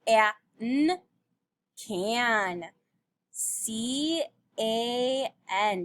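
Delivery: "K-a-n" sounded, then "C A N" spelled slowly; sounding then spelling. Shown as a demonstration. Teacher spelling can for children, slowly. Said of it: "Do you remember what this word is?".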